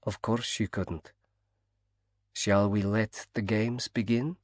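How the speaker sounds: lightly voiced East European accent; soft East European accent. A narrator's reading. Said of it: "in a soft East European accent".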